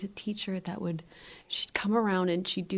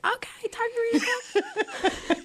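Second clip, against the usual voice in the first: much higher, slow and over-the-top; mock wrong bedtime voice; slower than usual. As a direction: ham up a voice nobody should use at bedtime. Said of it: "you wouldn't be like".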